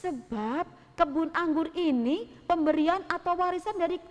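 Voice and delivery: a humble farmer refusing sadly. Acted. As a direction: Nabot gently pleading with the king. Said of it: "dengan perasaan yang sangat-sangat sedih".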